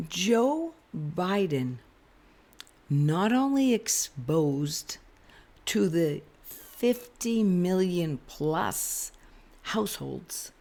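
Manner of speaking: slow, each word weighed; slow deliberate emphasis. Real, not acted. Vlogger to viewers, slow and deliberate. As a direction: lay it out word by word.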